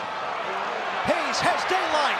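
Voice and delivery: drawn-out call. Real, not acted. Building play-by-play call.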